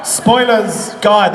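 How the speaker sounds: ordinary talk, nothing marked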